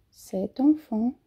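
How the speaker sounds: "C'est un" linked as set-un, slowly; slow, join the T to "un"; liaison demo. Shown as a demonstration. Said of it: "A gente faz a liaison". ("c'est un" ligado, devagar)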